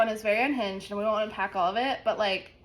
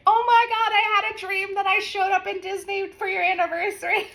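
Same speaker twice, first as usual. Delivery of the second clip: high, loud imitation of an excited friend; excited gushing; 9 semitones higher, 4 dB louder than usual. Friend quoted telling her Disney dream, excited gushing. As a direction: gush it out in a raised voice.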